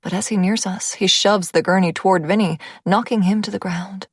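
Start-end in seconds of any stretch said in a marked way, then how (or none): none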